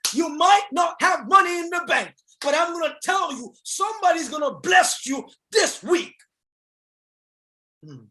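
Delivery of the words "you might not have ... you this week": a hint of God speaking to his son; lightly voiced inner voice preaching; voice from above. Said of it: "the voice says to me".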